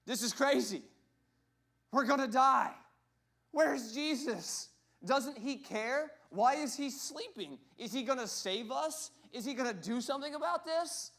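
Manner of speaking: high, loud, frantic cries of panic; terrified crew yelling over the storm; panicked disciples. Retold or imitated. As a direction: shriek like men sure they'll drown. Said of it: "They're saying".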